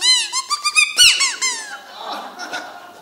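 loud, high burst of laughter; big laugh. Real, not acted. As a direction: break into hearty laughter. Laughing at his own line.

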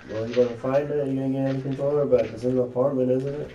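annoyed high questions. Real, not acted.

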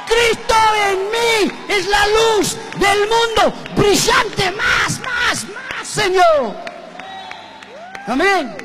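very high, loud proclamation with drawn-out words; full-force preaching. Real, not acted. Preacher calls on the Lord before the congregation, full-force shouting. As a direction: shout it heavenward, stretching each phrase.

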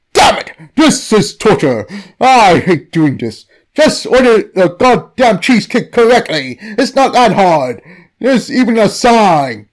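angry creature rant. Acted. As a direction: vent like a fed-up deity. The cheese god rants.

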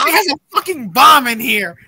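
rushed mock-panic holler; alarmed shout. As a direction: shout it urgently over the others. Repeating the bomb alarm.

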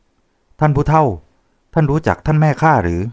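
put-on butter up the old man with a bright ask; fawning laugh-question. A narrator's reading. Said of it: "หัวเราะประจบถามว่า".

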